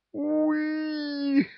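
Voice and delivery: very high squealed cry; screaming in chorus. A narrator's reading. Ghouls scream in panic at the northerners, very high shriek. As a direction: shriek it all together in fright. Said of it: "хором завопили гули".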